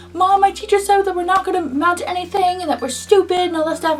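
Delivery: high, kid voice, upset, imitating herself; scene: telling her mom what the teacher said, high childish voice; younger self telling mom. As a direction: whine it like an upset twelve-year-old. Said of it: "and I was like".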